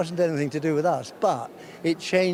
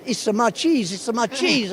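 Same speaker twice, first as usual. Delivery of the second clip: imitating a thick Spanish accent, pitched higher; a Spanish cheesemaker's excited claim, in a thick Spanish accent; 9 semitones higher than usual. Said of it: "a thick Spanish accent".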